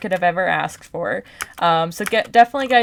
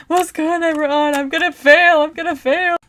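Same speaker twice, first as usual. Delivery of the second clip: much higher, strained replay of panic; panicked; 8 semitones higher than usual. Retold or imitated. Crying in the bathroom after freezing, high panicked voice. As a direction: relive the bathroom panic, pitched way up. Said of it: "and I'm just like".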